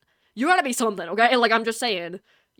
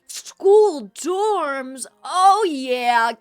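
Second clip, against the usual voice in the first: louder, thrilled gasp then "School dorms?"; excited gasp; 3 semitones higher, 10 dB louder, much slower than usual. Real, not acted. Streamer hearing she gets a dorm room, loud thrilled gasp. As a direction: gasp with delight.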